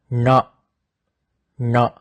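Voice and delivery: "na" with tongue pressed on the hard palate; isolated retroflex "na", slightly pressed; pressurized retroflex n. Shown as a demonstration. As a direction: curl the tongue to the roof of the mouth for "na". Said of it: "sounds a little bit pressurized".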